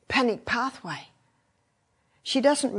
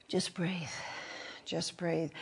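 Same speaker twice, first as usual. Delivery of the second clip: much softer, soothing "Just breathe"; calming breath cue; 3 semitones lower, 11 dB quieter, slower than usual. Shown as a demonstration. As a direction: lull the listener into breathing slowly. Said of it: "Breathe deeply".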